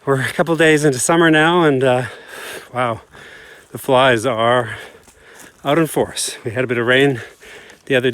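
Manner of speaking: breathless after running; out of breath. Real, not acted. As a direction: talk while catching your breath. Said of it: "I'm a bit out of breath".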